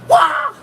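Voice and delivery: high, loud Bruce Lee scream; Bruce Lee kiai. Retold or imitated. Explaining shouting to viewers, a high loud Bruce Lee kiai. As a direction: let out a sharp martial-arts wah. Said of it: "That's why Bruce Lee".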